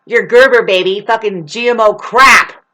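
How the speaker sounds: loud, mocking disgust; scolding outburst. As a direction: spit out the brand with force. Acted scolding builds to outburst.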